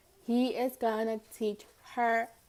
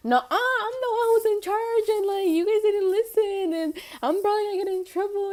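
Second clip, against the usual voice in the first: high, loud panicky rant; imitating freaking out; 11 semitones higher, 6 dB louder than usual. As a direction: rattle it off high and frantic. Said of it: "we were all freaking out".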